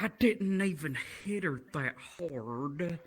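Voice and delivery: very slow, drawn-out imitation; Ethan's talk. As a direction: drag each word like a slow dim guy. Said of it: "That's how he talks".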